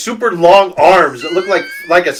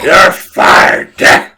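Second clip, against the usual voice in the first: louder hammed-up boss yell; mock wrestling-boss shout, louder; Vince McMahon shout; 9 dB louder, much slower than usual. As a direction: bark the firing at Dick. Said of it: "Vince McMahon his ass".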